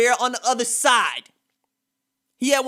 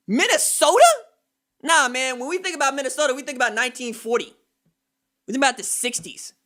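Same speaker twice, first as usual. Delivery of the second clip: ordinary talk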